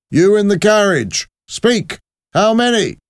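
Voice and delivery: high-pitched, forceful checkpoint challenge; barking soldier. Acted. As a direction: demand an answer like a soldier.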